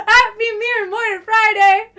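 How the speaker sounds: high, loud festive greeting; excited cheer. Real, not acted. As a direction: shout the Friday greeting with glee. Greeting a viewer on stream.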